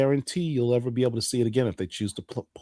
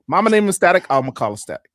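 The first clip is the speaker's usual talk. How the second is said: loud higher punch on named, then a settled I'ma; emphatic insistence; 5 semitones higher, 6 dB louder, faster than usual. Acted. To the audience, refusing to say static shock. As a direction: lay down the law on the name.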